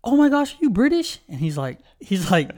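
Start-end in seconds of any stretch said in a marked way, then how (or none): 0.1-1.2 high, louder imitation of excited girls. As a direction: mimic girls gushing in a raised pitch. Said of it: "these chicks were like".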